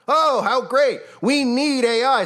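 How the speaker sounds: drip with sarcasm; sarcastic. Real, not acted. Sarcastic remark on deepfakes.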